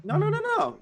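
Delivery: high squealing no's; squealed protest. Pretend. Goblin protesting to Rita, high squeal. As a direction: squeak out the refusals in a panic.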